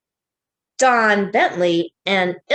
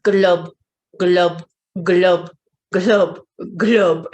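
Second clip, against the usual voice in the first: fish glub; 3 semitones lower, slower than usual. Shown as a demonstration. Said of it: "Can you do this?".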